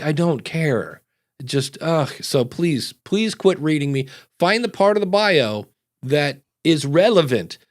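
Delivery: ordinary talk, nothing marked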